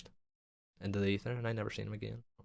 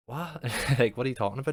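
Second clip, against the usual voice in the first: high, louder incredulous retort; retold disbelief; 6 semitones higher, 7 dB louder than usual. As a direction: act out baffled disbelief, half laughing. Acts out disbelief at denial.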